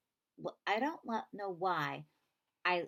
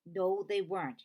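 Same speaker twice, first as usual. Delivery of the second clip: yelled; 3 semitones higher, 7 dB louder than usual. A narrator's reading. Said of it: "he yelled".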